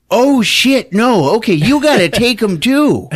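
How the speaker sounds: alarmed Lou. Retold or imitated.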